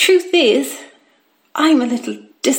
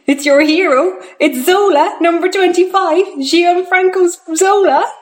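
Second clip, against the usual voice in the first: higher, rising announcement; excited reveal; 7 semitones higher, 4 dB louder than usual. Acted. Dad revealing the hero to his son, bursting with excitement. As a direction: build like a proud announcer to the name.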